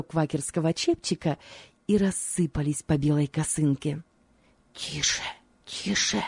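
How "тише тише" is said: soft, hushed shushing; whispered hush. A narrator's reading. Rachel quiets her overjoyed friend, in a whisper. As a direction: shush her under your breath. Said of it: "сказала Рахиль шёпотом".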